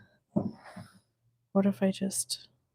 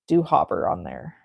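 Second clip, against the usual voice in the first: playful low voice; 11 semitones lower, 5 dB quieter than usual. Shown as a demonstration. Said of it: "I kind of want, like, a little round".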